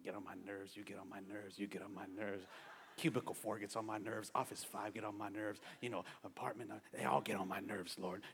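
low, very quiet mock grumble; grumbling to oneself. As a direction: complain under your breath about everyone. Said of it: "And we're like this".

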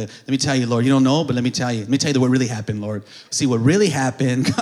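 low, insistent mock prayer; informing God. Believer briefing God as if he doesn't know, insistent mock prayer. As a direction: press your case to God on one low note. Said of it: "we pray like we're telling God".

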